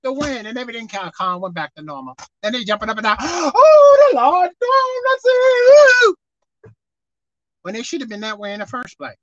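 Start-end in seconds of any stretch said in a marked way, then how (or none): 3.2-6.2 high, loud, slow rejoicing shout; mock jubilant cry. Relieved disciples praising the Lord, high loud jubilant cry.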